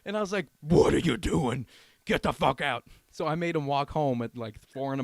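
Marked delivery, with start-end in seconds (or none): 0.6-2.8 loud, drawn-out mock outrage; retold angry outburst. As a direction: act out throwing the friend out, loudly. Retold kicking friend out.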